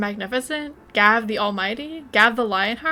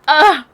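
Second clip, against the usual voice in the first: sudden cry; 6 semitones higher, 14 dB louder than usual. Real, not acted. Emotional outburst at scene.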